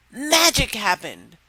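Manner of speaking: ordinary delivery, nothing marked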